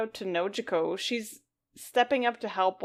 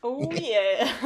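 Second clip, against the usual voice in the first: hammed-up mascot catchphrase; Kool-Aid Man; 3 semitones higher than usual. Co-host echoing the quote as a joke, mock mascot voice. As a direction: echo it as a big cartoon mascot. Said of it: "A Kool-Aid man's out of the water".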